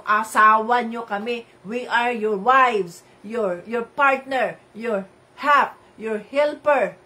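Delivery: loud, slow, every phrase hammered home; preaching at full force. Acted. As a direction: declare it to the whole congregation, slowly. Preaches louder, slowing down. (malakas at mabagal na pangangaral)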